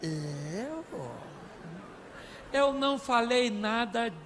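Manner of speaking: slow, drawn-out denial; mock-innocent husband. Husband denying to his crying wife, mock innocence. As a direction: stretch it out in exaggerated disbelief. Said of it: "Sabe o que ele fala?".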